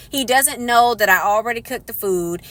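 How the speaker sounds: ordinary talk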